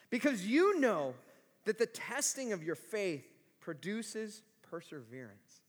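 measured, solemn reading with long pauses; scripture reading. Acted. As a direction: read the verses slowly to a standing congregation. Reads scripture to the congregation.

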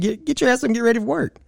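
high, fast scolding rant; mock scolding. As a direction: tell her off in a rush. Scolding the woman, much higher, fast.

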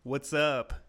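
high, giggly hey there; laughing mock greeting. Boy laughing through his retold hello to the girl. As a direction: greet her while cracking up.

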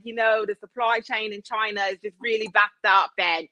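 imitated British businessman making excuses; British accent. Owner blaming the supply chain to customers, mock British accent. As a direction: explain away the delay in a put-on British accent. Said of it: "do this accent".

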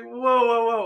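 loud, high retold whoa of shock. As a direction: throw out a loud high whoa, half mock. Retold shock at the wine taste.

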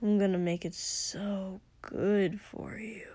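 soft, slow hushing whisper; breathy reassuring whisper; soothing whisper. Acted. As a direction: hush him close and gently. Soothing partner in intimate scene.

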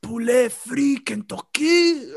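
"Poulet Frit Kentucky" slow, in a put-on French accent; "Poulet Frit Kentucky" in a mock French accent, slowly; French pronunciation. Shown as a demonstration. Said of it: "as the French like to call it".

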